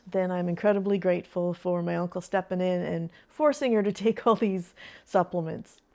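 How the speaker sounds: normal talking voice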